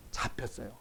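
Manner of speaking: whispered, barely voiced answer; whispered reply to his own question; hushed answer. Real, not acted. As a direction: breathe the answer out as if confiding. Answers own question under breath.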